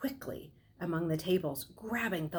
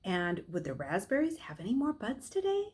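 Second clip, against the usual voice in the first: plain reading voice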